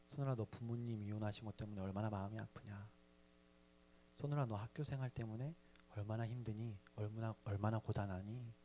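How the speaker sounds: soft, gentle consolation; tender comforting voice. Retold or imitated. Pastor seeming to comfort the hurting boy, soft and tender. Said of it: "마치 이렇게 말씀하시는 것 같아서".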